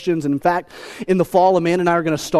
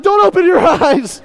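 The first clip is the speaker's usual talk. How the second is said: high, loud, laughing panic; laughing plea; 10 semitones higher, 5 dB louder than usual. Retold or imitated. Dad pleading with his son during the mess, laughing panic. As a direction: beg him through laughter. Baby opens eyes mid-mess.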